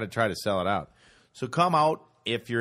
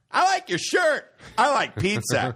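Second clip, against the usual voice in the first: high, raised mock stand-up patter; hammed-up clueless comic shouting; shouting out things; 10 semitones higher, 4 dB louder than usual. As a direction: holler it at an imaginary crowd. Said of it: "Just shouting out things".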